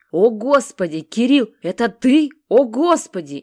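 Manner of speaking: loud, high cries of shock; shocked gasp. A narrator's reading. Sasha seeing Kirill on the intercom, shocked and loud. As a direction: cry out in disbelief at the door camera.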